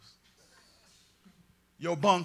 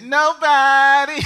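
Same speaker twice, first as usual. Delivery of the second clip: slow, drawn-out crooner's reply, louder and higher; a mock R&B singer's lament; lightly imitated soul crooner; 13 semitones higher, 19 dB louder, much slower than usual. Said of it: "I read that in [name]'s voice".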